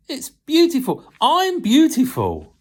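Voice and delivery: high, loud and slow wonder; high slow gasp of amazement; amazed delight. Acted. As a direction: marvel at her own beauty. Cup amazed at itself.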